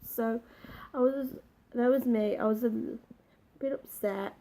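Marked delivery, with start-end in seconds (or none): none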